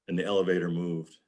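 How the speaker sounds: ordinary talk, nothing marked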